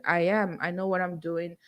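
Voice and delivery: normal talking voice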